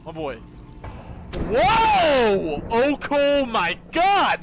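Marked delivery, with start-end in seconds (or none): 1.5-4.4 shout. Real, not acted. Startled exclamation.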